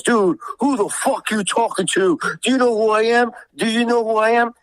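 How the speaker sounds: high-pitched mock outrage; indignant challenge. Retold confrontation, indignant challenger.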